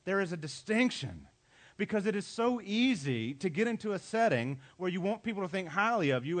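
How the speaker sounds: usual speaking voice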